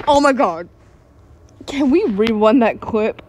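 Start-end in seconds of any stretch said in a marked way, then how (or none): none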